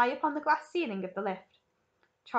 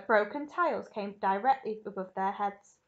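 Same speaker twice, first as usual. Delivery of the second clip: ordinary delivery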